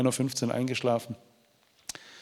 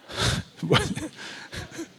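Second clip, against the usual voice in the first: breathy laugh. Real, not acted. Joke about Trinity walk.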